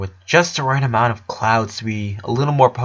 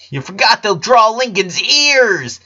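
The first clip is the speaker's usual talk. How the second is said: high, slow whiny complaint; little girl whining; complaining; 10 semitones higher, 5 dB louder, slower than usual. A narrator's reading. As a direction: sulk about the drawing. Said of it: "complained Lena".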